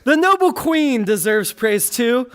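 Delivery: ordinary talk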